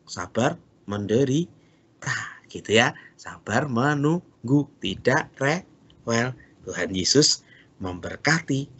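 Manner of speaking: ordinary talk, nothing marked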